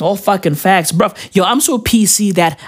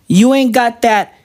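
ordinary delivery

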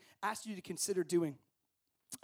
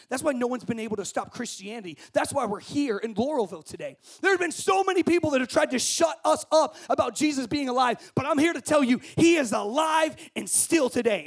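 loud, high, shouting climax; full-force preaching; 7 semitones higher, 13 dB louder than usual. Acted. Preacher to campers, shouted sermon climax. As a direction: preach it out big, building to the cry.

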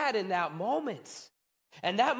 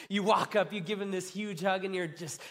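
ordinary delivery

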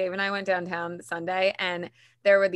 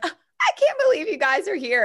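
thrilled quote; 10 semitones higher, 7 dB louder than usual. Retold or imitated.

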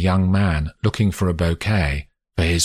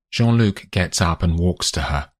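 usual speaking voice

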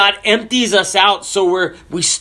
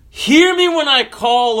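higher-pitched, pleading call to God; mildly raised cry of prayer; 7 semitones higher than usual. Retold or imitated. David calls on God in distress, crying out. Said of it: "And David cries out".

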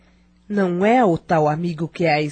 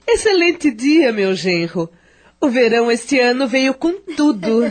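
forced cheer; 6 semitones higher, 6 dB louder than usual. Acted. Mother-in-law covering conversation, forced cheer.

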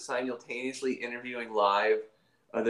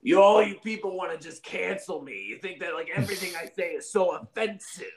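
Dino Dad voice; 5 semitones higher than usual. Acted. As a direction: rant like an offended cartoon dad. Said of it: "talk about it in Dino Dad's voice".

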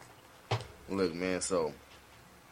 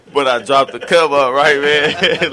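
laughing; 6 semitones higher, 12 dB louder, much slower than usual. Real, not acted. Laughing retelling his first release.

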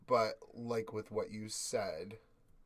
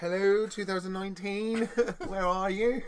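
mock calling out; 11 semitones higher, 10 dB louder than usual. Joining the mock call-out.

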